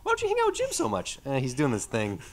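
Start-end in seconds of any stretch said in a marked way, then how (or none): 0.1-1.2 high mock voice. Imagined friend asking about Jim.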